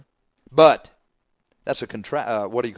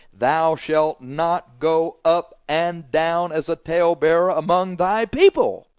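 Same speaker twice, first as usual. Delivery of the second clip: slow, higher and drawn out, each word weighted; slow emphatic quote; 3 semitones higher, 3 dB louder, slower than usual. Shown as a demonstration. Preacher driving the verse at the talebearers, slow and emphatic. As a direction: hammer the verse home slowly. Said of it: "emphatic repeat of the verse".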